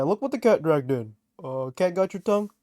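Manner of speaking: ordinary talk, nothing marked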